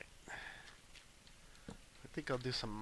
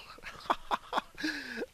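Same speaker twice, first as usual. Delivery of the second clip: high cackling laugh; laughing at your own joke; amused laugh. Real, not acted. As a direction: burst into high, staccato laughter.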